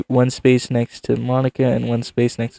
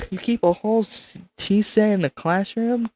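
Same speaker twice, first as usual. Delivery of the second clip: higher character voice; 10 semitones higher, 3 dB quieter than usual. Acted. Voicing character, high.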